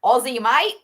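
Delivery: playful put-on Australian; Aussie accent. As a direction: lay on a thick Aussie accent. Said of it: "I'm better at".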